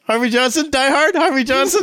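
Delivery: high-pitched, excited rising question; incredulous questioning. Retold or imitated. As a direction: repeat it back in amused disbelief, voice way up. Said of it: "I was like".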